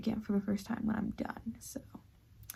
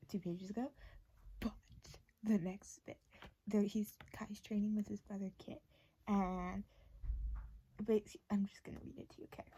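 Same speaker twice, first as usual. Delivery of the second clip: ordinary delivery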